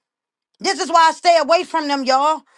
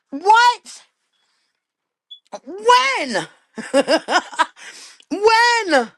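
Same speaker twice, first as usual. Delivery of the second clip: incredulous disbelief; 6 semitones higher, much slower than usual. Real, not acted. Reacting to brother's testimony claim.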